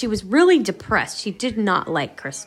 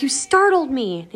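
mock startled, higher and drawn out; 6 semitones higher, slower than usual. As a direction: act out being caught off guard, slowly. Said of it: "and he startles her".

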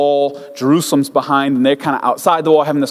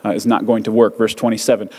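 normal talking voice

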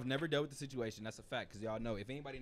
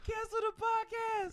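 mock dismay; slower than usual. Joking after the shock.

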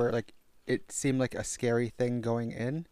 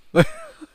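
shrieking laugh; 9 dB louder than usual. Real, not acted. Laughs at imitation.

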